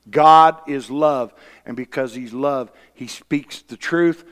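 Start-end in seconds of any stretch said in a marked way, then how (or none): none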